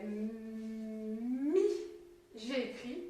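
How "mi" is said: MI with a very long M sliding up into I; stretched-out sounds. Shown as a demonstration. Teacher recaps the written syllable, stretched-out sounds. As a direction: hold "mmmm" then release the "i" of "mi". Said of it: "si j'ai bien regardé, j'ai fait".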